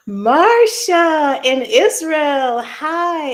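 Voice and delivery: loud, slow drawn-out call; excited greeting. Real, not acted. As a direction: call out her name with joy. Loud greeting to a viewer abroad.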